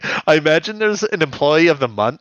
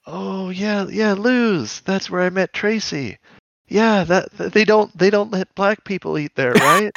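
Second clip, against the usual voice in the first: wistful dying soldier; 7 semitones higher than usual. Pretend. Dying soldier answers buddy.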